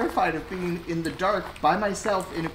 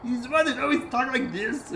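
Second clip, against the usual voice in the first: failed Bobcat impression; 5 semitones higher than usual. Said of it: "can't do his voice".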